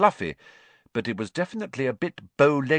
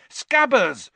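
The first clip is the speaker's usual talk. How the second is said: much higher, louder shout; 7 dB louder than usual. Acted. Ron shouts after his escaping rat, higher and louder. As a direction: yell after the fleeing rat. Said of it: "Ron shouted".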